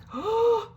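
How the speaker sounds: sharp high gasp of shock; stunned gasp. Real, not acted. A sharp gasp after hanging up the phone. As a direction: gasp in sudden disbelief. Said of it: "just stunned".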